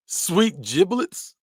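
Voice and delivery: high, slow drawn-out sweet giblets; mock folksy exclamation. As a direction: savour it like a country grandpa. Trying out folksy phrase.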